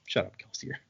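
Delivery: normal talking voice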